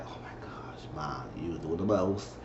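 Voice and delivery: whispered, embarrassed complaint; annoyed whisper. Retold or imitated. Grace embarrassed by her mother, whispered complaint. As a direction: hiss it so Mom can't hear. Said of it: "She was like".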